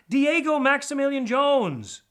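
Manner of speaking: high, loud, slow formal announcement. Acted. DJ announces his full name to the rat, loud and slow. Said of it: "cleared his voice for a louder announcement".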